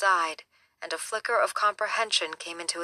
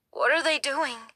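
put-on high, shaken boy's voice; a scared kid's voice, wavering up high; 8 semitones higher than usual. Boy watching the body bags, high and shaken. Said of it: "he asked in a high, shaken voice".

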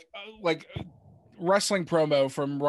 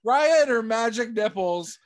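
laughing; 4 semitones higher, 6 dB louder, slower than usual. Real, not acted. Laughing rant about the movie.

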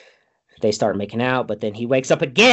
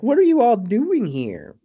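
high, loud incredulous question; hammed-up shocked outburst; mock astonishment; 10 semitones higher, 5 dB louder than usual. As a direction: ask it in exaggerated disbelief, voice leaping up. Acted astonishment in retelling.

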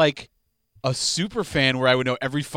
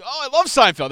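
eager fake fan; 12 semitones higher, 8 dB louder than usual. Mock eager fan reply.

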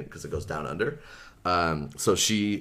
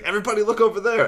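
fast, higher and louder shout to the crowd; calling out; 14 semitones higher, 7 dB louder, much faster than usual. Retold or imitated. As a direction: yell quickly so everyone turns. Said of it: "and is like".

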